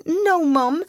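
put-on high, pleading servant girl; protesting maid. A narrator's reading. Maid denying she eavesdropped, high and pleading. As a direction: protest it quickly. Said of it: "Becky protested".

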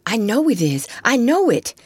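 high, rising agitated insistence; wild. A narrator's reading. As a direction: insist wildly, building on the repeat. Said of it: "said Eliza wildly".

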